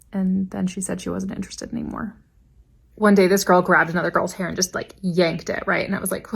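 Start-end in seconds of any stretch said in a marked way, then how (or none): 0.1-2.2 breathy confiding whisper; softer gossipy whisper; whispered aside. Real, not acted. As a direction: lean in and whisper the punchline. Confiding punchline.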